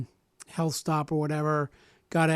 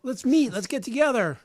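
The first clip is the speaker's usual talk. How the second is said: imitating a gleeful lawyer, pitched high; high, delighted mock lawyer voice; eager lawyer; 8 semitones higher than usual. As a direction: play the lawyer thrilled at a big case, voice up high. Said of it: "the guy's like".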